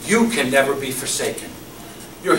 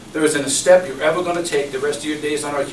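ordinary talk, nothing marked

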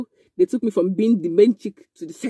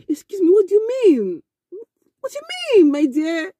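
much higher, rising in disbelief, near tears; retold shock; a sharply higher, disbelieving my dear; incredulous retort; 11 semitones higher than usual. Retelling her reply to the boy.

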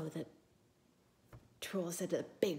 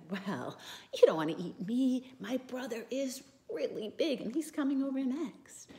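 middle goat; 6 semitones higher, 5 dB louder than usual. Acted. Middle goat bargains with troll.

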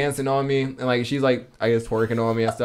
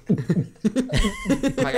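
laughing; 12 semitones higher than usual. Real, not acted. Laughing at the memory.

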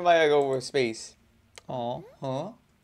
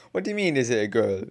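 normal talking voice

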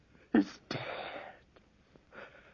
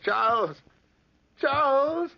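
frightened calling out; 14 semitones higher, 5 dB louder than usual. Acted. Old man calling out in terror.

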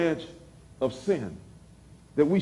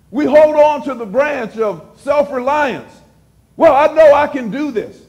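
raised preaching voice; 5 semitones higher, 3 dB louder than usual. Real, not acted. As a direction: preach it out to the congregation. Preaching to the congregation.

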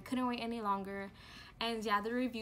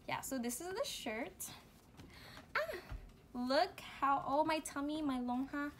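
ordinary talk

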